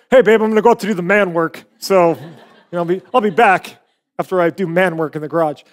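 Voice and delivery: lightly hammed-up man-of-the-house voice; mock manly talk. Husband telling his wife about man work, mock manly. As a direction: put on a touch of macho self-importance. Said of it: "we don't talk like that at home".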